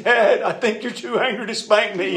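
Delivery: put-on high, loud childlike plea; a pleading little boy, hammed-up; imitating a frightened little boy. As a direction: pitch up into a scared kid's voice. Child pleading with angry dad.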